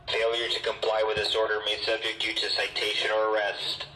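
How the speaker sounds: usual speaking voice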